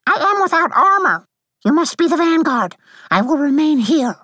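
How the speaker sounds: very high, loud sprite voice; tiny warrior issuing brisk orders; piping, forceful battle commands; commanding Lacuna. A narrator's reading. Lacuna gives orders in the story.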